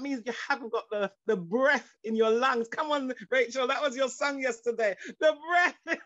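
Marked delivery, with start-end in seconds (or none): 5.2-6.1 breaking into laughter mid-phrase; real laughter. Real, not acted. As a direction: laugh through the words, then carry on slowly. Laughs while recalling Rachel's song.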